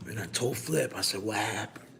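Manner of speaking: retelling a call with Flip in a hushed mafia voice; talking low like the mafia. Retold or imitated. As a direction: keep it hushed like a mob boss. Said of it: "they talk low".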